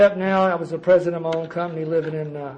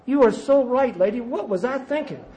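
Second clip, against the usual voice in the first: self-pitying inner rant; 5 semitones higher than usual. Retold or imitated. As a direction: whine at the world like it owes you. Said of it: "And I thought".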